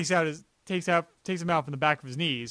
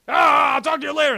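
high, loud mock yell; hurried villain; 10 semitones higher, 8 dB louder than usual. Retelling a villain hanging up the phone, hurried mock yell. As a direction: blurt it out pitched up, imitating a flustered villain.

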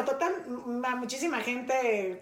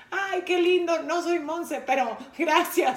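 rehearsed gracious reply; 6 semitones higher, 4 dB louder, slower than usual. Shown as a demonstration. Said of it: "he aprendido a hacer es".

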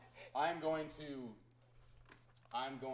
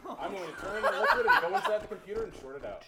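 excited declaration; 8 semitones higher, 18 dB louder than usual. Real, not acted. Excited player declaring action.

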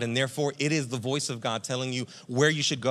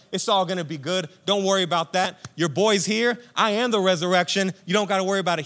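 loud, higher, cocky comforting boast; triumphant friend telling mourners not to worry; confident reassuring Jesus; 5 semitones higher, 6 dB louder than usual. Acted. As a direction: swagger in and promise it's all fine. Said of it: "instead of just being like".

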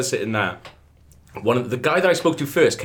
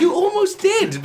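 mock crew member; slower than usual. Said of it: "It's like".

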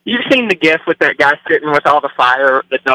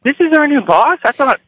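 put-on high, thin voice of a baffled young hire; high, hurt mock protest; high squeaky mock voice; 13 semitones higher than usual. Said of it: "And I was like".

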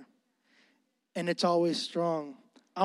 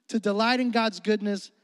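ordinary delivery

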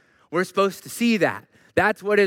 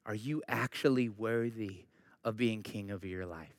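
softer, lower, sincere preaching with long pauses; quiet sincere appeal; 8 semitones lower, 6 dB quieter than usual. Real, not acted. Preacher quietly urging the congregation to honest self-reflection. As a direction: gently press each listener to look inward.

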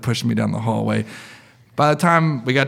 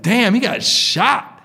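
high, drawn-out mock amazement; amazed onlooker; 10 semitones higher, 4 dB louder, much slower than usual. Patient watching the ER like a movie, amazed. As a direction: marvel at it like a gripped moviegoer.

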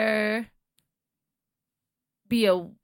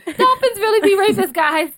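mocking the outburst; 10 semitones higher, 7 dB louder, slower than usual. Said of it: "that was so random".

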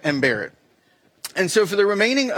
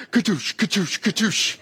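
mimicking a foot pump whooshing; imitated air pump sound; pump noise; 2 semitones higher than usual. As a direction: puff out each katoosh like air pumping. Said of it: "So he goes".

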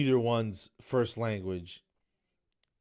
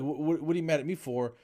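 higher, louder indignant protest; defensive protest; 6 semitones higher, 3 dB louder than usual. Retold or imitated. To his angry buddy, blaming the dad, indignant. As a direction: push the blame back, stammering. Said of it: "and I'm like".

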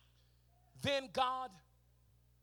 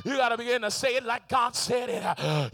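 loud, driving sermon climax; preacher urging the congregation to speak it aloud; preaching at full force; 8 dB louder than usual. Acted. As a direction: push each line harder to the crowd.

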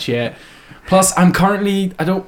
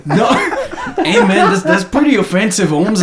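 hammed-up Mexican American accent with a laugh. Shown as a demonstration. Podcaster defending his impression to co-hosts, comic Mexican American accent. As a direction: protest amiably in a mock Mexican American accent. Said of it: "I'm just doing a normal, like, Mexican American accent".

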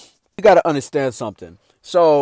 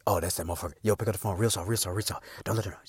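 low, hushed urgent murmur sinking to a whisper; hushed brothers; 10 semitones lower, 16 dB quieter, faster than usual. Retold or imitated. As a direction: keep it down so Tina can't hear. Brothers hushing as Tim calls.

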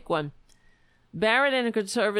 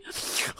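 mock gasp; 7 dB quieter than usual. Mocking Kavanaugh's hearing outburst.

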